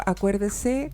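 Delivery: ordinary delivery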